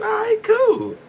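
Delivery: very high, falsetto all right cool; high falsetto answer to his daughter; mock squeaky reply.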